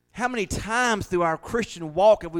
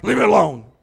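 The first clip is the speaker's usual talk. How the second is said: louder, grumpy mock snap; mock irritable snap; 11 dB louder than usual. Preacher mimicking the crash after a pill, loud and cranky. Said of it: "three hours later, you're like".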